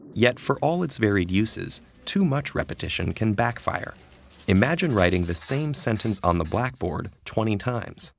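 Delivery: ordinary delivery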